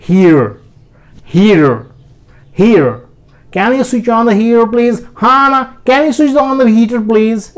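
"heater" said as hiro, T flapped to D; American flapped T. Shown as a demonstration. Teacher models American heater with flapped T. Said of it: "मैं जब बोलूँगा तो ये सुंदर लगेगा".

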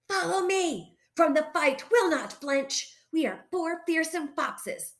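high-pitched rallying cry; rally the troops with bravado. A narrator's reading. Frank leading foxes to the farmyard, crying out. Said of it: "Cried Frank".

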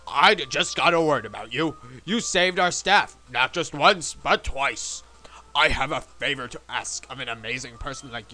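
Mister Stone. Acted. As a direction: speak with weighty, measured company-president importance. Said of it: "an extremely presidency voice".

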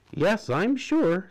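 sly and certain; Della sure. A narrator's reading. Della answering the doctor, slow and sure. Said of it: "said Della Ragan".

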